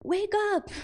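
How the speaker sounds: high, loud call to wake everyone; hammed-up wake-up cry; rousing town-crier shout. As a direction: holler it out like a street crier. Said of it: "That was me in my, in the fifteen hundreds".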